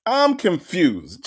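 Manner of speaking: normal talking voice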